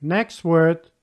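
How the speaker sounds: ordinary talk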